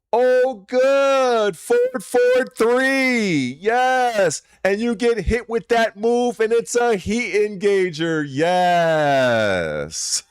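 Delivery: high-pitched, hammed-up cry of delight; mock ecstatic. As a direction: draw out each yes in exaggerated rapture. Hammed-up sarcastic celebration of a combo.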